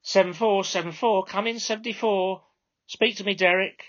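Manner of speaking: high, loud call into the mic; controller on the radio. Acted. Minicab controller calling her driver by microphone. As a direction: summon the driver over the radio. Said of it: "the controller leaned into her microphone".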